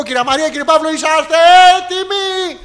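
a hint of a game-show host; mock announcement. Son announcing the news to his parents, lightly hammed announcer. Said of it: "Θα πω".

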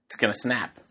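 ordinary talk, nothing marked

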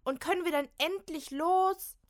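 high, loud, impatient snap; angry snap. Acted. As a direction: demand to finally leave. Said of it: "faucht Kim". (wütend ungeduldig fauchen)

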